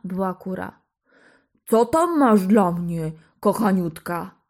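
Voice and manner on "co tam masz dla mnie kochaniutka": giant's voice. A narrator's reading. Giant addresses his hen.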